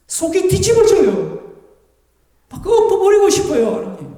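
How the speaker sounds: tattling to God. Shown as a demonstration. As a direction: pour out your grievance like a child telling on someone. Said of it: "일러 바치십시오".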